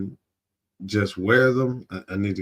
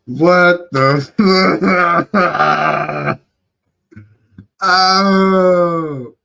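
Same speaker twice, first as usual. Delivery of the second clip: high, loud burst of disbelieving laughter; laughing outburst; 7 semitones higher, 10 dB louder, much slower than usual. Real, not acted. Reactor hears the stiff pickup line, laughing in disbelief. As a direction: crack up loudly mid-phrase.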